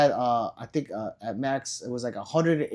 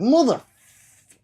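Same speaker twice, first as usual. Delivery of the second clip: drawn-out, much higher, louder mock curse; mock rage outburst; 12 semitones higher, 9 dB louder, much slower than usual. Streamer joking to viewers, mock furious outburst. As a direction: fake blowing up at the chat, stretched slow. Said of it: "I might be like".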